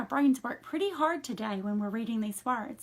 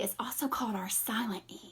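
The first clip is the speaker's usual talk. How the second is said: whispered line about the silent E; hushed whisper; 5 dB quieter than usual. Shown as a demonstration. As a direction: breathe it out, no voice, like a secret. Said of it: "He's very quiet".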